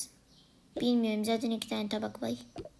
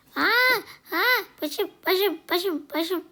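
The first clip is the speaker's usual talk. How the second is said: higher, louder cries of pain; pained cry; 9 semitones higher, 9 dB louder than usual. Acted. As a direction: cry out as your head is stepped on. Sister standing on her head. (acı içinde bağırarak)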